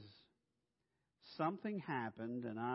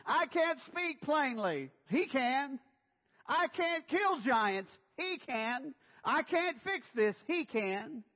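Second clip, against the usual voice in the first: full-force preaching; 12 semitones higher, 9 dB louder than usual. Acted. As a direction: preach it hard, building to the shout.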